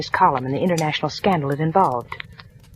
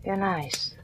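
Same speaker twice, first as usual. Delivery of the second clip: much softer, intimate murmur; tender; 8 dB quieter, slower than usual. Acted. Marla softly flirting with Harry, intimate murmur. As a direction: purr it close to his ear.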